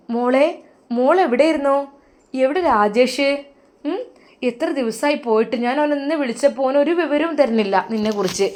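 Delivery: a startled mother asking where her son is; anxious mother-in-law. Acted. Mother-in-law anxiously questions daughter-in-law.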